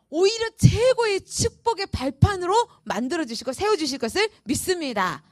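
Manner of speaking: ordinary talk, nothing marked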